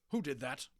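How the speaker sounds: sharp irritated teacher demand; irritable. A narrator's reading. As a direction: snap it like an annoyed headmaster. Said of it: "said Mister Johnson irritably".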